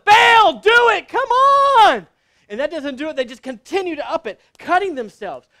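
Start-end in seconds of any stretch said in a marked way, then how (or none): 0.0-2.1 high, full-force drawn-out shout; yelling. Acted. Desperate prophets yelling at Baal to answer, full shout. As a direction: scream at the idol to act. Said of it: "then they start yelling".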